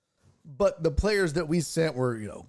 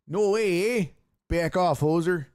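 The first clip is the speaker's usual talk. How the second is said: mock Canadian accent, higher and louder; Canadian hoser voice; 5 semitones higher, 3 dB louder than usual. Host celebrating that the movie was guessed, in a hammed-up Canadian accent. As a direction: crow it like a Strange Brew hoser. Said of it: "Strange Brew".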